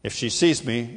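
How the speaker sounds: ordinary talk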